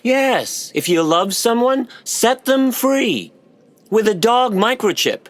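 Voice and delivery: high, lilting parental pronouncement; high-pitched, unapologetic conviction; lofty self-justification. Acted. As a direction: proclaim it like a cherished proverb. Parent proudly justifies the microchips.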